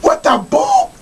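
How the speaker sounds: hammed-up cussing yell, pitch way up; mock Ramsay outburst. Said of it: "I cuss at people".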